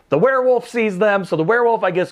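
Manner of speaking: normal talking voice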